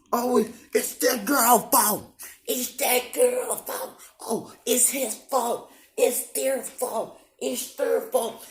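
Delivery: high, slow, whiny mock voice; mocking blamers. Mocking people who blame him, high slow whine. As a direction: drag it out high like a sneering gossip. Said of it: "motherfuckers already".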